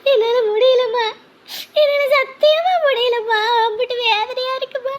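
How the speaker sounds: breaking down in tears to her mother; slow crying plea; sobbing. Acted. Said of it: "நீ அழாத, சரியா?". (அம்மாவிடம் அழுதுகொண்டே புலம்புதல்)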